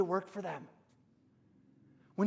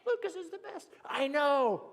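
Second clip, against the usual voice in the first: higher, teasing bully voice; big brother twisting an arm, taunting higher; mock sibling taunting; 6 semitones higher than usual. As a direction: gloat over your pinned little brother. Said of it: "until he said".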